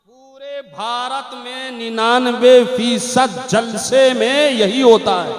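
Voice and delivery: very loud, slow drawn-out declamation; full-force preaching. Real, not acted. Preacher charging gatherings across all India, slow booming declamation. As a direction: stretch each word and boom it out.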